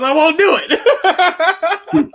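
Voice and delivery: high, slow laughing whine; whiny refusal. Retold or imitated. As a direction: stretch the refusal out like a sulking kid, giggling. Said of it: "He goes".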